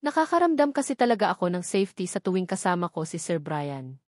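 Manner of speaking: usual speaking voice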